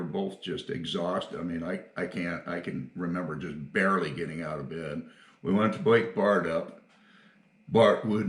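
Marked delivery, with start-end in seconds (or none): none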